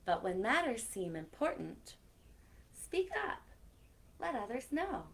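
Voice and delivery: lively storybook read-aloud; reading to children. A narrator's reading. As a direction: tell it like story time for kids. Reads picture book aloud.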